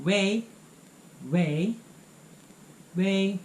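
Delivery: "喂,喂,喂" drawn out slowly, rising like answering a call; phone hello wei; phone greeting wei. Shown as a demonstration. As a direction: say "喂" three times, slow and questioning into the phone. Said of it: "you say".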